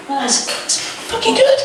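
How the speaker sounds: ordinary delivery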